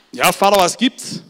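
hoch und laut, fragend; Sohn am Telefon. Retold or imitated. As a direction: wie ein verwunderter Sohn am Hörer. (Sohn Alfons fragt laut und verwundert nach)

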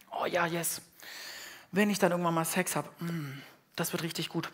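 softly imitating a hopeful single person; hushed, dreamy anticipation; dreamy daydream. Preacher imitating his old hopeful daydream, hushed and dreamy. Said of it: "dann habe ich mir gedacht".

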